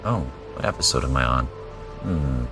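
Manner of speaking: ordinary talk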